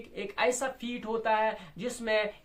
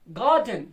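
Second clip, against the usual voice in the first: Garden with a loud गार and light डन; garden dropping off after a strong first beat; strong-weak stress; 6 dB louder than usual. Shown as a demonstration. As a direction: punch the first syllable of garden. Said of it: "पहला strong है".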